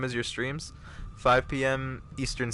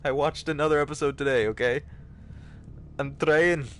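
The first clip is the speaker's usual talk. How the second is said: Scottish attempt sliding into Indian, pitched up; muddled Scottish accent; 4 semitones higher than usual. Streamer defending the effort to chat, muddled Scottish accent. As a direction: plead your case in a wonky Scottish accent. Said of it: "That was a mix between Indian and Scottish".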